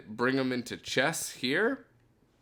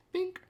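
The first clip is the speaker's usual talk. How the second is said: tiny high-pitched "bink"; very high, playful blip; imitated bink noise. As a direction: squeak out a little sound effect. Voices priming the machine.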